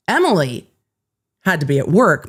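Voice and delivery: ordinary delivery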